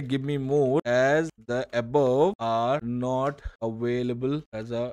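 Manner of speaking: very slow, word-by-word prompt reading; spelling out the follow-up request slowly; slow dictation. Shown as a demonstration. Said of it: "जैसे".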